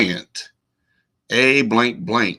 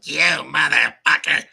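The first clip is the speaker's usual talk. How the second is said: higher put-on caricature of a wife; exaggerated parody of what the wife said; mocking wife voice; 5 semitones higher than usual. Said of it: "sometimes I'll be like".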